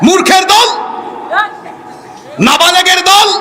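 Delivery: high, shouted denunciation; angry high-pitched sermon shout; preaching at full force. Real, not acted. Preaches, berating textbook authors.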